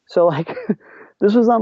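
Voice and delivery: ordinary talk, nothing marked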